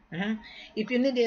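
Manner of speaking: usual speaking voice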